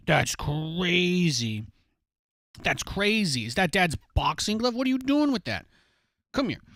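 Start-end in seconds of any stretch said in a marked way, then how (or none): 0.1-1.7 very slow, stretched-out mock awe; slow teasing marvel; drawn out. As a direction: drag every word out slowly.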